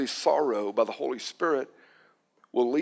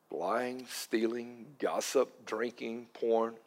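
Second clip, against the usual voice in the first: hushed list; 4 semitones lower, 8 dB quieter, much slower than usual. Acted. Listing sins quietly.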